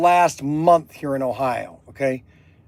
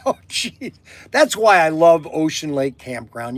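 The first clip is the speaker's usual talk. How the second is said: usual speaking voice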